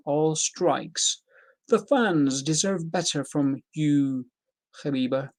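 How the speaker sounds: deliberate British read, slowing toward the end; British accent. Acted. Reading a fighter's angry reply, in a British accent. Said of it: "In a British accent, I'll say".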